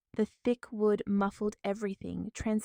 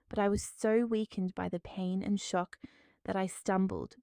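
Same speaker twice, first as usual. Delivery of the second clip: ordinary delivery, nothing marked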